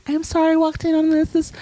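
high-pitched, sheepish imitation; guilty. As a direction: apologize like a guilty little girl. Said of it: "Elise looks guilty".

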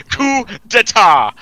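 high, loud, slow announcement; mock proclamation. Friend declares a takeover of the podcast, mock proclamation. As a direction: proclaim it slowly, drawing out each syllable.

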